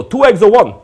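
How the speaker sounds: put-on loud, high-pitched immigrant New York accent. Griddle man taking a deli order, immigrant New York accent. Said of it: "That was an immigrant New York accent".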